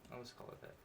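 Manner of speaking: whispered aside. Real, not acted. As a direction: whisper it to yourself. Muttered aside after fumbling name.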